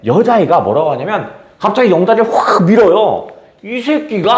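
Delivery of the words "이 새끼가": rough talk. Retold or imitated. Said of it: "아주 거친 말투를 합니다".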